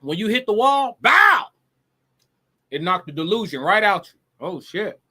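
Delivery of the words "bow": loud, high shouted "bow"; explosive crash noise made by voice; imitated impact. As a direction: punch it out like a sudden crash. Acting out hitting the wall.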